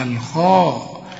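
الخاء as a slow, held KHOO; lengthened kha. Shown as a demonstration. Teacher shows alif after kha, drawn-out الخاء. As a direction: stretch الخاء on a thick long vowel. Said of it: "عشان تمدها".

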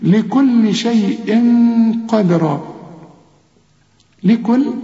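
لكل شيء drawn out very slowly; slow recitation. Shown as a demonstration. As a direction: chant it slowly like Quran recitation.

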